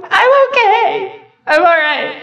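high, slow, teary voice breaking; tearful reassurance. Retold or imitated. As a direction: imitate yourself crying while insisting you're fine. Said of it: "And I cried".

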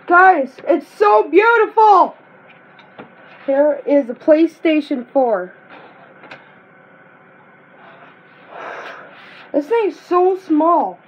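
high, loud, slow gushing delight; excited. Real, not acted. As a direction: gush over it, thrilled. First sight of the console.

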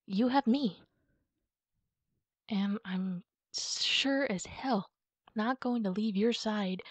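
normal talking voice

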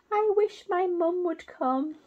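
high, hopeful little wish; wishing owlet. Acted. Baby owls wish for mother, high.

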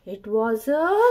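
ordinary delivery

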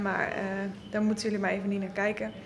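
ordinary delivery

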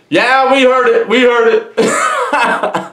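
high, drawn-out call breaking into a laugh; mock hollering back. Inmates answering that they heard it, mock holler. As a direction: holler back high, stretching the last words.

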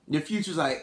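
ordinary talk, nothing marked

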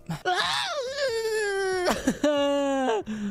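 a high, wailing laugh-cry of despair; anguished cry-laugh. Real, not acted. Gamer losing progress, high anguished laughing wail. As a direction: let out a pained cry at the game.

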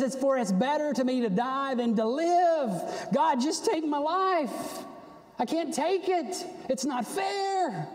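high, protesting wail to God; aggrieved, high-pitched outburst; angry complaint. Acted. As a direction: plead and protest, pitch climbing, words drawn out. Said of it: "And he's angry".

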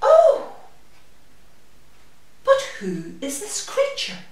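high gasped oh, then a breathless whisper; gasp, then whisper in terror; sudden fright. Acted. Mouse suddenly seeing the creature, gasp then whisper.

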